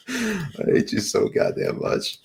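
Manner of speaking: ordinary delivery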